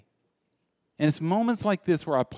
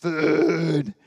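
put-on kid grabbing for food; 3 dB louder than usual. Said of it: "they reach in the dog food bowl and go".

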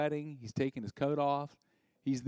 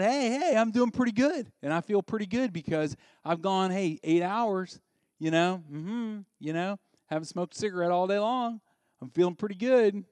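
much higher, bright and pleased with himself, mock proud; smug cheerful prayer; 8 semitones higher, 5 dB louder than usual. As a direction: brag to God like a kid who behaved all day. Prayer acted as a proud sinner.

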